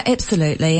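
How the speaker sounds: normal talking voice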